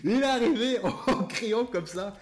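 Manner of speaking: high, loud telling through laughter; laughing while telling. Real, not acted. Storyteller remembering his father's arrival, laughing hard. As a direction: crack up as you recall it. Cracking up at his own memory.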